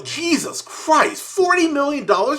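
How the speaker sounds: high, slow, drawn-out gasp of shock; stunned disbelief. Real, not acted. Reviewer learning the budget, high stunned disbelief. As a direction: let the number floor you.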